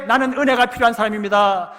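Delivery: very loud, high desperate shout. Retold or imitated. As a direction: give it everything you have. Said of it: "더 크게".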